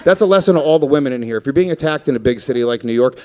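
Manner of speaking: ordinary talk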